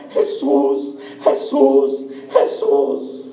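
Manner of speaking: re-enacted strained cries, no real shout; mock crying out, held back in volume; imitated repeated crying out. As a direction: imitate someone calling on Jesus over and over. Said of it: "ella gritaba y gritaba".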